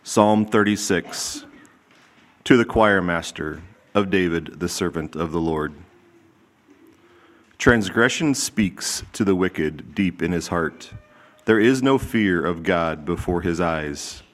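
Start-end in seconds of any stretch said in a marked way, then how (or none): none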